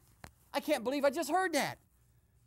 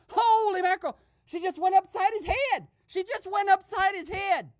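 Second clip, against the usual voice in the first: loud, high, excited holler; shocked outburst; 8 semitones higher, 7 dB louder than usual. Pretend. Eavesdropper reporting that she went upside his head, shocked outburst. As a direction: cry out in astonishment, much higher and louder. Overhears a slap, reacts in shock.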